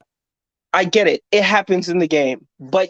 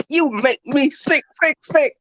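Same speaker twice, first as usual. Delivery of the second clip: mock scolding; 6 semitones higher than usual. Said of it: "She was like".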